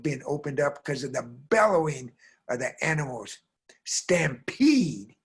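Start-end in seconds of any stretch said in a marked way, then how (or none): 3.8-5.2 dramatic whisper. Retold or imitated. Dread at stampede's start.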